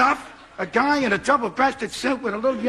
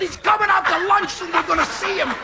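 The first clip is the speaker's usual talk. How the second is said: higher, thrilled repeat of the news; excited echo; 7 semitones higher than usual. Retold or imitated. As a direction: echo it back giddy with star excitement.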